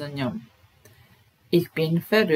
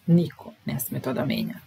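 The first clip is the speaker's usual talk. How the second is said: usual speaking voice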